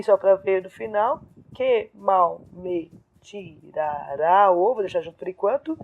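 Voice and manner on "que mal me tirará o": slow, measured syllables; syllable-by-syllable scansion. Shown as a demonstration. Teacher scanning the last verse, slow separated syllables. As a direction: step through the verse syllable by syllable.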